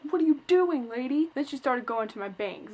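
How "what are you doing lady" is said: higher, louder mock alarm; startled protest, half played; retold alarm. Retelling alarm at the hairdresser cutting too much, higher and louder. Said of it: "and I'm like".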